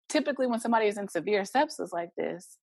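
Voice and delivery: ordinary delivery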